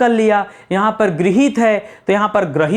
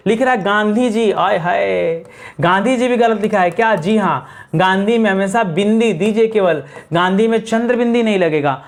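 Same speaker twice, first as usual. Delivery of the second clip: normal talking voice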